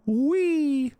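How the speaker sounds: squeaky high playful wee. Retold or imitated. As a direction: squeal it like a kid on a ride. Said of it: "and he went".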